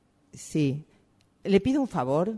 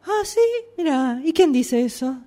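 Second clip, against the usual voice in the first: much higher, sarcastic "ah, ¿sí?"; mock surprise; 8 semitones higher than usual. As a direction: fake astonishment to brush her off. Boss mocks rumour, second take.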